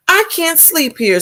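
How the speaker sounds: high, whiny ladybug. A narrator's reading. Teacher voicing the dizzy ladybug, high and whiny. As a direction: whine it up high. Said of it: "said the lazy ladybug".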